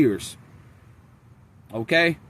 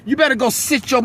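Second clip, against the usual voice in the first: father telling off a pestering son, raised voice; dad scolding; 7 semitones higher than usual. Retold or imitated. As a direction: scold the kid like his father, voice up. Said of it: "My dad like".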